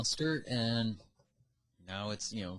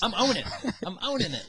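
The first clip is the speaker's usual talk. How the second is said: high, loud, laughing boast; mock proud; 10 semitones higher, 6 dB louder than usual. Co-host owns his slip before friends, mock proud. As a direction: proudly claim it in a raised voice. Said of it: "You were so proud".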